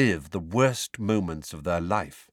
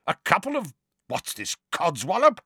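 high, incredulous uncle; scornful disbelief; 10 semitones higher than usual. A narrator's reading. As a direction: query the nonsense word scornfully. Uncle scornfully questions unknown word.

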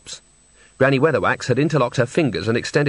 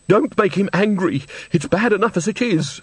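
high-pitched frightened pleading; terrified; 8 semitones higher than usual. A narrator's reading. Old woman begs Granny not to anger him, terrified. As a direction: beg them, voice raised high in fear. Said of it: "The woman was clearly terrified".

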